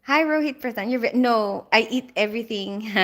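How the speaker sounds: ordinary talk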